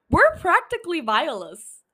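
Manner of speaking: laughing through the words. Real, not acted. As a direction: giggle as you say it. Laughing at her own joke.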